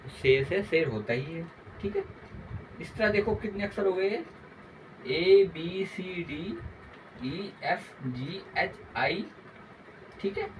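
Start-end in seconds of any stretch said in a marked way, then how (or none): 5.1-9.2 slow recitation. Shown as a demonstration. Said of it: "reciting the alphabet slowly".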